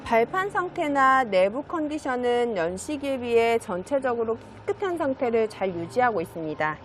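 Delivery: normal talking voice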